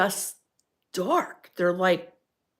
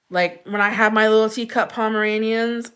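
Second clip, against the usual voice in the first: normal talking voice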